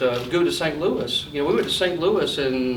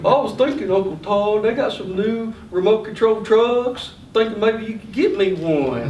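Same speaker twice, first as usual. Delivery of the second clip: put-on coaxing little boy; 4 semitones higher than usual. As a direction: sweet-talk your uncle into a toy. Nephew angling for a toy.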